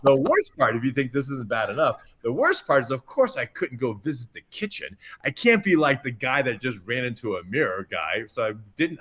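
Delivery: usual speaking voice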